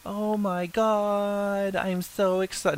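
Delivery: high-pitched, drawn-out fake gushing; mock excitement. Host feigning joy at co-host's baby news, mock excited. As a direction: gush in a high, stretched-out put-on squeal.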